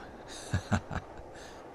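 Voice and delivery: breathy, quiet wicked chuckle; soft evil chuckle. Acted. Demon gloats to the listener about the stabbed friend, soft evil chuckle. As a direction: chuckle under your breath, pleased.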